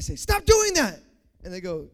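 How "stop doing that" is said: high-pitched, emphatic order; acted firm command. Telling someone to stop, firm acted command. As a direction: order them to stop, voice jumping up.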